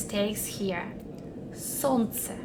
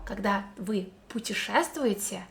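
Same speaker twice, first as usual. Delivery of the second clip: ordinary delivery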